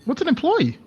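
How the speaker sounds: put-on high, loud childlike question; curious little kid. Kid pointing at a door, high curious voice. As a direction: pipe up like a nosy small child.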